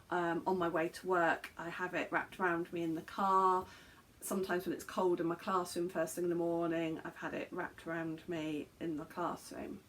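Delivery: usual speaking voice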